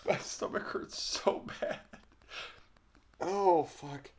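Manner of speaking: high, winded laughter; laughing. Real, not acted. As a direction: gasp words out between laughs. Still laughing, stomach hurting.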